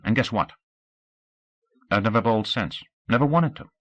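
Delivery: steady and sensible, reasoning with her; earnest young Scout. Acted. Scout reasons with Audrey about their future, earnest. Scout tells bowling story.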